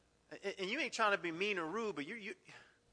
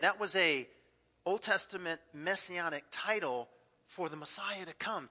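ordinary talk, nothing marked

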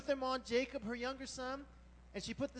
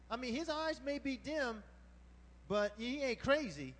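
ordinary talk, nothing marked

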